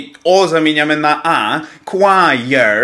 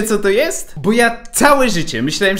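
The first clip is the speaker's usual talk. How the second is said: normal talking voice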